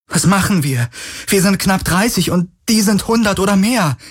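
high-pitched panicked protest; rising, frantic despair; desperate alarm. A narrator's reading. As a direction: plead for a plan, outnumbered. Kirai despairs as enemies approach.

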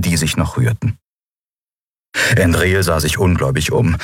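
plain reading voice

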